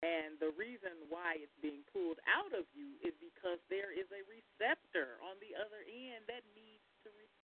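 faint, far from the mic; barely audible. Real, not acted. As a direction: voice fading out on the line. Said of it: "I can barely hear you".

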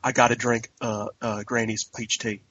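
low, softened mock Southern accent; Lauren Cohan impression. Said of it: "She's like".

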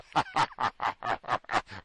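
imitated cackling of the pranksters; put-on giggling fit; mock laughter. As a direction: laugh in mockery, a long rattling run. Mimics staff laughing at prank.

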